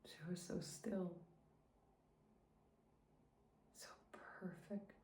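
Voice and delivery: soft, barely voiced grief; broken whisper. Mother recalling finding her baby, in a grieving whisper. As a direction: whisper it as if it still hurts.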